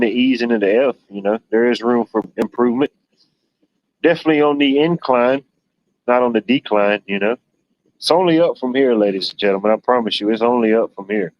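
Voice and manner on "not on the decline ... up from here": ordinary delivery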